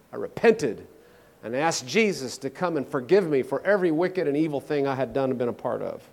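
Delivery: normal talking voice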